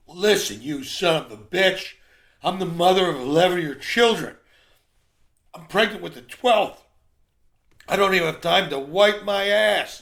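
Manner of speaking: high, loud shouted tirade; furious wife. Acted. Pregnant wife snapping back at her griping husband, furious shout. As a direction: yell it indignantly as the exhausted mother. Wife's outburst in a told joke.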